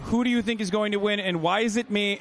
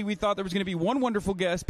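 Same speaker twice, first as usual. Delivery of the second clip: normal talking voice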